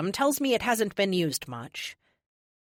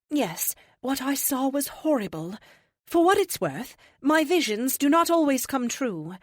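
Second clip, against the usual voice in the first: serene higher oracle, gently amused; 7 semitones higher, 3 dB louder than usual. Acted. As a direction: speak like a calm ancient seer. Oracle character voice.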